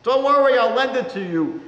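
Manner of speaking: loud, high peasant reassurance; hearty peasant. A narrator's reading. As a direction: brush off her worry as the woodseller. Rabbi as Vasil reassuring.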